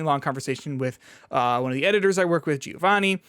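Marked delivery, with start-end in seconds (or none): none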